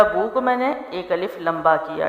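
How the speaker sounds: usual speaking voice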